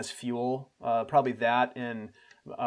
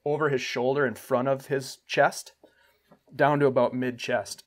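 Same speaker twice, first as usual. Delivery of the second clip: ordinary delivery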